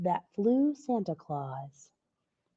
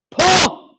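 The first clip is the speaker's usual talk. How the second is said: louder scoffing pah; dismissive laughing pah; 9 semitones higher, 10 dB louder than usual. Acted. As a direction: brush the worry away with a laugh. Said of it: "laughed Santa Claus".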